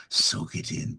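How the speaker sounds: breathy tempting whisper. Acted. Jekyll side coaxing listeners to soak it in, whispered. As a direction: hush it out like a secret. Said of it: "And Irradiated Chaos".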